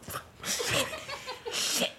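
high yelps of pain and swearing; pained cursing. Acted. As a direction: shot robber cursing in agony. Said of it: "he's like".

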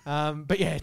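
ordinary talk

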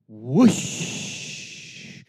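low breathy whoosh of waves. Shown as a demonstration. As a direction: push air out like a wave. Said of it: "I want you guys to go".

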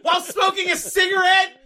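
hammed-up shouted rant, high and loud, laughing through it; mock furious yell. Girlfriend yelling at her cop boyfriend in the restaurant, hammed-up furious shout. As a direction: rant at him like the outraged girlfriend.

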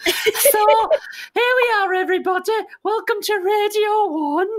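high, loud, slow mock DJ voice; mock radio host. Mocking the contestant's radio-presenter voice, high and hammed-up. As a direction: ham up a wet radio presenter. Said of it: "It sounds like he's making his confirmation".

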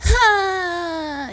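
high, sustained "ah"; sound-effect "ah". Shown as a demonstration. Player demonstrates their game sound effect, high drawn-out "ah". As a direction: hold one long high note on "ah". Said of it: "is gonna be my sound effect".

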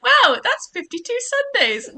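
high, laughing wow; mock amazement. Quotes own mistaken amazement, laughing.

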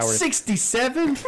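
hearing a weak rating, stunned; incredulous. Real, not acted. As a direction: stretch it out in disbelief. Reacts to a low rating.

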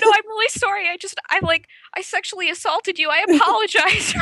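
high, flustered, laughing-edged apology; frantic apology. Retold or imitated. To the stranger whose thigh she grabbed, a high flustered apology. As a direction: gush out sorry in a panic. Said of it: "I'm like".

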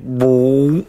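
drawn-out stomach gurgle noise; imitated stomach rumble. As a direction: voice a slow rumble in the belly. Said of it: "I can hear it in my stomach".

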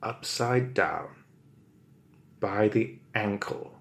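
usual speaking voice

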